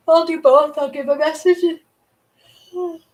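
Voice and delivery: talking through a yawn. Real, not acted. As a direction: say it mid-yawn. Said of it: "Sorry about the transitional yawn".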